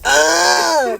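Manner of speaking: high, loud cartoon yelp; mock cry of pleasure. Bobber's candy figure crying out mid-act. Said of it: "and he goes".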